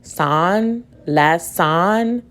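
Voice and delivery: "son" given a full short o, rising; weird full vowel. Shown as a demonstration. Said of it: "Huh, that sounds weird".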